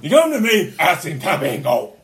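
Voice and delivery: loud mock tough-guy bluster; Al Pacino impression. Player confronting Meslier, blustering Pacino impression. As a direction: ham up a confronting film star. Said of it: "recording as Al Pacino".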